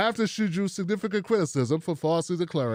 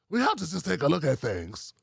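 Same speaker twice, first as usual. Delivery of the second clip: normal talking voice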